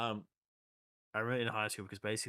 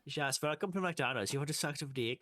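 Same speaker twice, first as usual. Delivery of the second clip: mock fast-food greeting; 7 semitones higher, 4 dB louder than usual.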